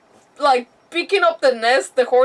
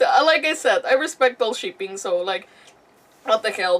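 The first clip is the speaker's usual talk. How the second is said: normal talking voice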